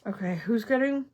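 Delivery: talking around a pin; mumbling with a pin between lips; pins in mouth. Real, not acted. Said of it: "I shouldn't try to talk with a pin in my mouth".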